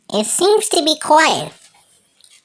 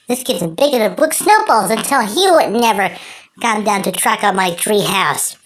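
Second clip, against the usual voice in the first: usual speaking voice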